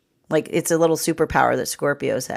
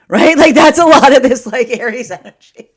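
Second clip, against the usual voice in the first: laughing; 8 semitones higher, 12 dB louder than usual. Real, not acted. Bursts out laughing at own point.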